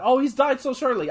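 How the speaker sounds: high mocking complaint; mocking whine. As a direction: whine it like an upset fan. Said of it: "they were saying he's died".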